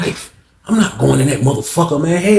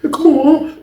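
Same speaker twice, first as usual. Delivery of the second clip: pleading; 14 semitones higher than usual. Retold or imitated. Victim pleading.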